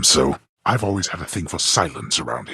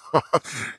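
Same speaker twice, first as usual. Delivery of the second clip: short dry laugh; 8 semitones higher than usual. Acted. Brief laugh at a remark.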